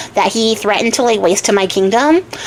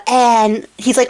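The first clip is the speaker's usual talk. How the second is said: plain reading voice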